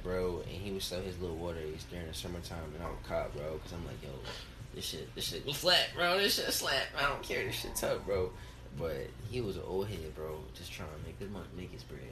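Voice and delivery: ordinary talk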